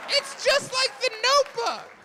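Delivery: high swooning falsetto; mock swoon. Comic telling the audience the romance, in a high swooning falsetto.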